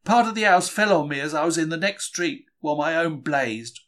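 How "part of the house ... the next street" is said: ordinary delivery, nothing marked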